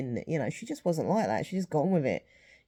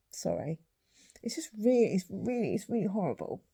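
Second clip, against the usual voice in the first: voice breaking; 4 semitones higher than usual. Real, not acted. Breaking down over mum's death.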